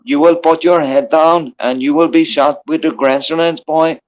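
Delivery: lightly faked Irish tough guy giving mock orders; put-on Irish accent. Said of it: "I tried to make up a good accent".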